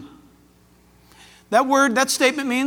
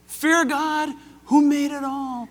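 high, slow proclamation; broadcasting angel; 5 semitones higher, slower than usual. Acted. Preacher voices the angel's warning to the world, slow proclamation. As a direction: call it out slowly like an angel addressing the world. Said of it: "This angel's gonna broadcast somehow".

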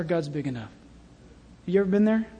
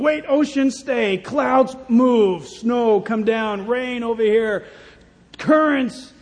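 a hint of God barking brisk commands, louder; God giving orders; 3 semitones higher, 5 dB louder, slower than usual. God ordering ocean and clouds, brisk and loud. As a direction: snap out orders to the elements.

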